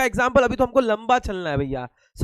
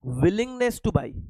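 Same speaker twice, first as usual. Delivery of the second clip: slow emphasis; 4 dB quieter, much slower than usual. Shown as a demonstration. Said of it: "teacher stressing the key term".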